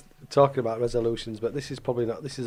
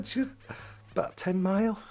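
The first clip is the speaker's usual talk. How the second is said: laughing through the words; fighting back giggles; suppressed laughter; 2 semitones higher than usual. Real, not acted. Laughing about the Great Wall.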